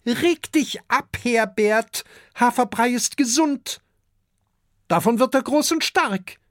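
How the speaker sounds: very high-pitched dragon-wife voice; female dragon Adele. A narrator's reading. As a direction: put your husband in his place, high-voiced. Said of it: "sagt Adele". (hohe, resolute Drachinnenstimme)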